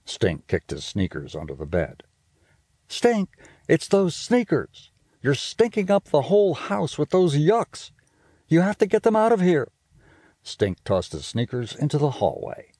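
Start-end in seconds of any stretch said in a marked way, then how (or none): 2.9-9.7 high, quick bossy big-sister voice; a know-it-all sister teasing her brother; Judy's voice. Acted. Big sister scolding little brother about smell, high and bossy. Judy speaking in story.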